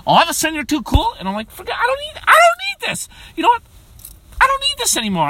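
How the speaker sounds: high-pitched mock indignation; hammed-up upset. Uncle to kids who are too cool, hammed-up high upset. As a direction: squeak out an offended protest. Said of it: "so upset".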